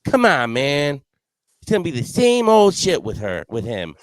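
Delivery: high, loud hammed-up buddy voice; mock teammate. Teammate retold scoffing at the vote choice, high and loud.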